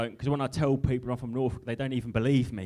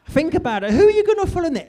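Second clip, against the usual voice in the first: exasperated outburst; 13 semitones higher, 8 dB louder, faster than usual. Real, not acted. Exasperated rhetorical rant to crowd.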